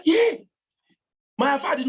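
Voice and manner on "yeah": short, high, loud yell; a sudden "Yeah!" shouted high; retold shout. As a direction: cry out sharply, higher and louder. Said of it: "I shouted".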